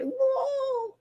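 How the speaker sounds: very high, drawn-out ghostly moan; wailing lost souls. Acted. Gardener voicing weeds as Ursula's lost souls, high ghostly wail. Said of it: "all like".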